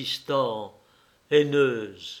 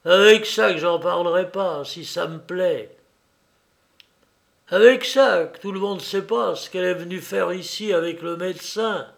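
defiant taunt; 5 semitones higher, 4 dB louder than usual. Acted. Quarrel, woman taunting.

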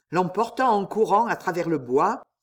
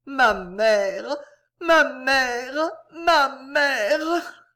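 higher, louder, breaking into a sob; sobbing cry; 7 semitones higher, 3 dB louder, much slower than usual. A narrator's reading. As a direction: cry out mother in tears, building. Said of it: "répétant le mot sublime". (Jeanne sanglote sur la tombe de sa mère, voix qui se brise)

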